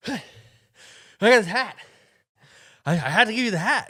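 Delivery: high, loud, gasping rush; out of breath. Retold or imitated. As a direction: pant it out after sprinting. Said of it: "he's, like, out of breath".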